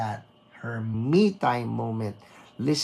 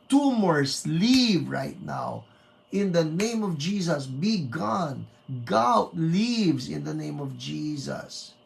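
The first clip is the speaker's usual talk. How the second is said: raised, forceful declaration of healing; fervent preaching; 3 semitones higher, 3 dB louder than usual. Acted. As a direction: charismatic preacher proclaiming healing.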